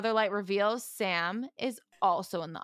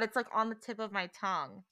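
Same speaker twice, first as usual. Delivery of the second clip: usual speaking voice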